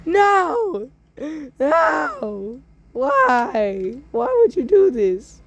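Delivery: high hammed-up wail of grief; mock-tragic sobbing outcry; anguished cry. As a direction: cry out over a fallen friend. Friend shot, crying out.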